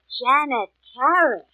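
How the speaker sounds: drawn out slowly, thinking; slow musing repeat. Acted. Mary repeating the murdered girl's name to place it, slow and musing. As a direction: turn the name over slowly in your mind.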